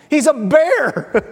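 real laugh through slow words. Real, not acted. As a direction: chuckle out "He's a bear" slowly. Laughs at his own bear image.

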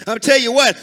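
ordinary talk, nothing marked

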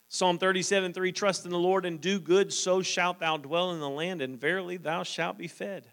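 measured, lifted reading of the verses; scripture reading. Acted. Preacher reading psalms to the church, measured and lifted. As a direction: read the psalm aloud to the congregation.

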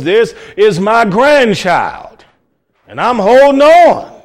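grandma vowing to hold on, building; determined grandma. Retold or imitated. Grandma clutching her sick grandbaby, building determination.

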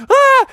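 high, loud acted shriek; panicked scream. A narrator's reading. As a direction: shriek as danger closes in. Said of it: "He started to scream in panic".

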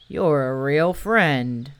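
low, slow gloomy donkey voice; a mopey donkey being grateful. Acted. Eeyore thanks Pooh.

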